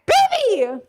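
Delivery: very high, drawn-out squeal; re-enacted shriek of delight; excited scream. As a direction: squeal it like you found treasure. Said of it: "I went like that".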